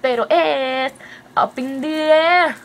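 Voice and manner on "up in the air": slow, louder, higher title; announcing a favorite song; drawn-out song title. Shown as a demonstration. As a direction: present the title grandly.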